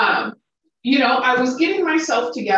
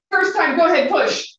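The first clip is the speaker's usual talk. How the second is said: pretend novice intern; 7 semitones higher than usual. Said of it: "If they're like".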